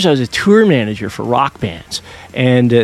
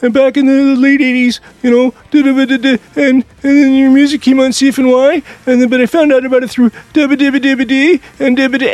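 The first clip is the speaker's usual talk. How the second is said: much higher, louder rapid gushing; nervous fan babble; 14 semitones higher, 5 dB louder than usual. Retold or imitated. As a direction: gush nonstop at your idol. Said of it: "verbal diarrhea coming out of my mouth".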